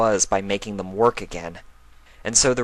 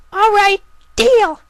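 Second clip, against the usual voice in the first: very high, louder filly voice accepting; bold filly; 10 dB louder, slower than usual. Acted. Filly accepting her brother's dare, very high and loud. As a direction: seal the deal with gusto.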